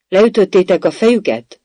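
high, loud demand; blaring shout. A narrator's reading. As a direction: shout the question across to soldiers. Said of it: "harsogta a katonák felé a királynő".

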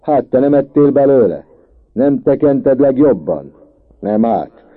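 loud, high accusing shout; heated schoolboy yelling; quarrelling. A narrator's reading. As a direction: throw the accusation back at full voice. Boys shout accusations at each other.

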